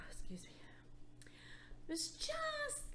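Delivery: soft, slow whisper while drowsy; sleepy whisper. Acted. Teacher pretending to nod off in class: slow sleepy whisper. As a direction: drift into a sleepy half-whisper. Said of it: "I must be a little sleepy".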